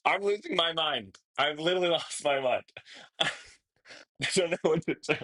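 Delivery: giddy, flustered meltdown; overwhelmed, laughing. Real, not acted. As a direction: laugh helplessly through the words.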